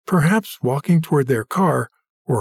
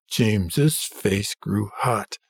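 usual speaking voice

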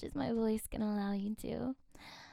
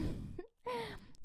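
high giggle; 12 semitones higher, 7 dB quieter than usual. Real, not acted. Giggling mid-countdown.

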